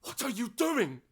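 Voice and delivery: high, desperate shout. Acted. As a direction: yell it at her, pleading and furious. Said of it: "Stop shouting!".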